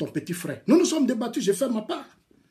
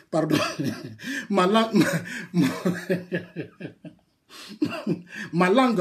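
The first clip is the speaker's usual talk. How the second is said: giggling while fumbling for a word; laughing. Real, not acted. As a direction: laugh through the words, losing the thread. Fou rire en cherchant le mot. (en riant, cherchant ses mots)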